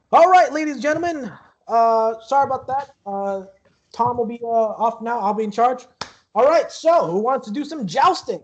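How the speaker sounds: high, loud host addressing a crowd; jousting host. Acted. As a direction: announce it loudly, pitched well up. Game master plays jousting host addressing the crowd.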